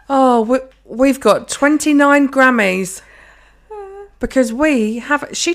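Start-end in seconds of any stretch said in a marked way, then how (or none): 0.1-3.0 raised, loud fan bragging; slow, loud pretend bragging as if it were yours; mock boast.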